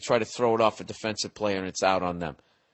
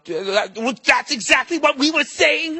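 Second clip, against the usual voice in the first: bad mock German accent, much higher and louder; 12 semitones higher, 4 dB louder than usual. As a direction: ham up a Nazi officer in a bad German accent. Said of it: "a bad German accent like I just did".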